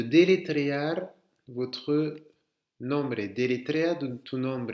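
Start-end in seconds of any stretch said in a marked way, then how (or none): none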